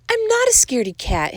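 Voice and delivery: very high indignant kitten retort; indignant hissing. A narrator's reading. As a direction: protest high, without whispering. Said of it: "hissed the gatito".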